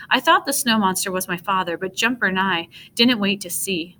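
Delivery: usual speaking voice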